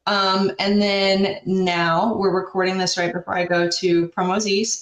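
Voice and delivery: ordinary talk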